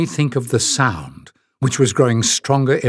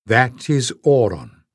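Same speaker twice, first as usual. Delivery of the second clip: ordinary delivery, nothing marked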